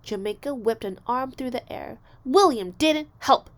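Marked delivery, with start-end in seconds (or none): 2.2-3.5 loud, high, slow protest. A narrator's reading. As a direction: insist loudly it's unfair. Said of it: "she protested".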